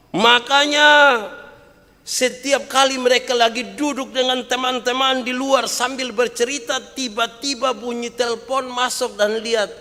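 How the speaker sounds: high, loud, driving sermon voice; fiery pulpit delivery; preaching at full force. Acted. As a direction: hammer the point to the mothers.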